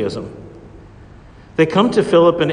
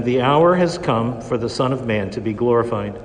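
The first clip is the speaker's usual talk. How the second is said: ordinary talk, nothing marked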